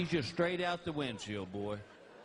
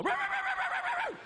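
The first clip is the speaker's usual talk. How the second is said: high yapping puppy barks. Retold or imitated. Puppy sneaks up on the sleeping cat, yapping. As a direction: yap like a little cartoon puppy. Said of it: "puppy would sneak up and go".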